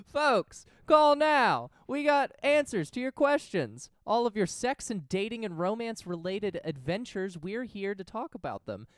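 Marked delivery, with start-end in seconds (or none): 0.1-6.1 excited announcer. Acted. Hyped call-in pitch.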